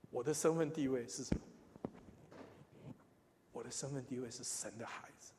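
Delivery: normal talking voice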